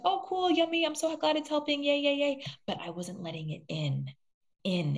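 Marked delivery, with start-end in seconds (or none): 0.0-2.4 high, sing-song fake glee; cutesy put-on enthusiasm; mock gushing delight. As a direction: imitate breezy cheer, pitched way up. Mimics her blocking the love.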